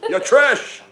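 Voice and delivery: high, loud politician's rant; Bernie Sanders. Acted. Streamer insulting a viewer as a politician, loud high impression. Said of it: "Bernie Sanders".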